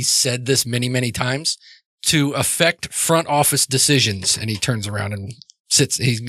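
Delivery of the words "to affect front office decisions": slow, measured, rehearsed recital; metered pace. Acted. Father repeating his rule to Joe, slow metered pace. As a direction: space it out like a much-repeated rule. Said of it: "in, like, a very metered pace".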